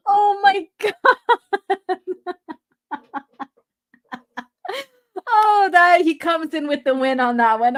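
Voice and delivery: high, laughing, near crying; can barely speak for laughing; real tearful laughter; laughing to tears. Real, not acted. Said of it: "I'm, like, in tears right now".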